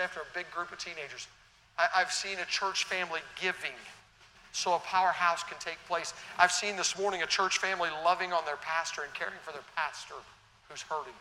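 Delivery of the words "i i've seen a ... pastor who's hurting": rising, louder preacher build; preaching. Acted. Preaching at full force.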